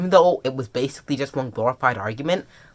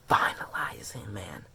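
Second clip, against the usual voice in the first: near-whisper, fading away; soft, breath-only murmur; hushed awe; 7 semitones lower, 13 dB quieter than usual. Real, not acted. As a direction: let it sink to a whisper. Awed at the story's final moment.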